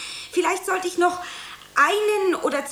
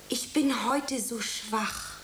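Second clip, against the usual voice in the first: softer and slow, distant and nearly sad; quieter, sad; 2 semitones lower, 6 dB quieter, slower than usual. Shown as a demonstration. Actress demonstrating the line to her director, soft and sad. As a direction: say it as if from far away. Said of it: "viel leiser und mit innerer Distanz, fast traurig".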